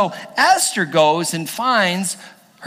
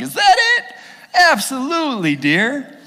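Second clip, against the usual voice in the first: high, loud comic surprise, then easing down; delighted king; 6 semitones higher, 3 dB louder than usual. Acted. King agreeing to Esther's second feast, delighted. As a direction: agree eagerly, starting high and settling.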